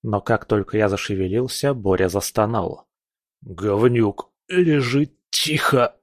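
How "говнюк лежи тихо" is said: wheezed out while squeezing tight; hoarse croak. Acted. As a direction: croak it through pain. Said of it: "прохрипел он".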